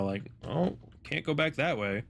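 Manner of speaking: ordinary talk, nothing marked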